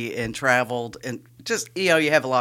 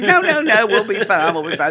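mock pleading protest; 9 semitones higher, 9 dB louder than usual. Retold host protesting in car.

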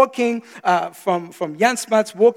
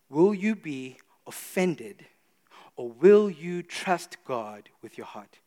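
slow solemn question; 5 semitones lower, 6 dB quieter, slower than usual. Real, not acted. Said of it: "slow solemn choice".

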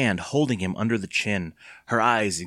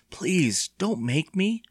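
higher-pitched, voiced plea; desperate whisper; 8 semitones higher, much slower than usual. A narrator's reading. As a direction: beg Mom urgently. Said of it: "he said, whispering".

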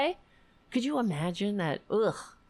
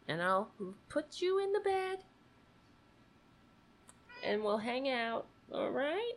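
soft, coaxing pet talk, much softer; soothing talk to dog; 4 semitones higher, 8 dB quieter than usual. Real, not acted. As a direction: coo to the little dog to calm him. Talking softly to her dog.

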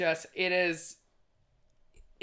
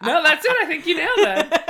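laughing; 10 semitones higher, 11 dB louder than usual. Real, not acted. Laughing praise of pronunciation.

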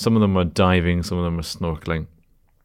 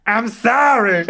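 high, loud mock wail; hammed-up seasick American shriek; shouted impression of seasick American; 5 dB louder, slower than usual. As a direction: shout it out between imagined heaves. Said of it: "she would just shout".

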